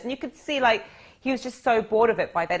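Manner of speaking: normal talking voice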